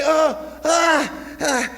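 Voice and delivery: loud high gasping ahs, waking from a nightmare; mock nightmare cry. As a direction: cry out from a bad dream, acted. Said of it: "I would wake up, and I'd say".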